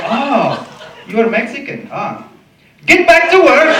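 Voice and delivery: high, loud shout through laughter; mock the foreigner, yelling and laughing; laughing yelled heckle. Workers yelling back at the comic, laughing.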